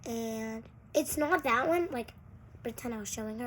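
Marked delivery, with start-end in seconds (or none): none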